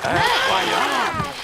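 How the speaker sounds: slow, high, loud exclamation of wonder; amazed. A narrator's reading. Narrator marvelling at the steam boat. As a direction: stretch it out in awe.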